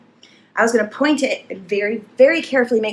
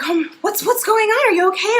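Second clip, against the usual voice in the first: put-on higher, worried boyfriend asking; concerned boyfriend; 10 semitones higher than usual. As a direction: play him alarmed at the sink. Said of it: "my boyfriend at the time was like".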